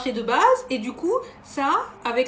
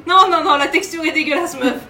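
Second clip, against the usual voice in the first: disgusted; 4 semitones higher, 9 dB louder than usual. Real, not acted. Disgusted after tasting octopus.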